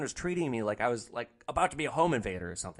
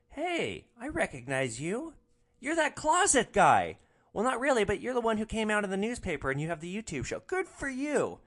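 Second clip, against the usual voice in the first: much higher, bubbly, thrilled; squealing higher with delight; excited recognition; 10 semitones higher, 3 dB louder than usual. Retold or imitated. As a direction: gush like you just spotted a celebrity. Passenger recognizes the driver.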